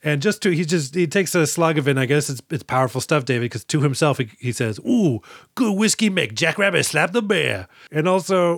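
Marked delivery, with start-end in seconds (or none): 4.9-7.7 light imitation of a Cajun accent. Uncle tasting his moonshine, mild Cajun accent imitation. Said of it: "Wilford Brimley attempting a Cajun accent here".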